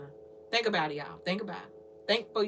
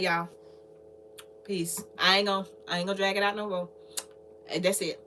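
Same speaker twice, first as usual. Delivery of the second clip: ordinary talk, nothing marked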